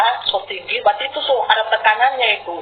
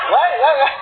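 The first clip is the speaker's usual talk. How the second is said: higher-pitched mock coarse Sulawesi chatter; imitated rough talk; 5 semitones higher than usual. Preacher mimicking everyday talk back in Sulawesi, mock coarse. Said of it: "Di Sulawesi gaya bahasanya kasar".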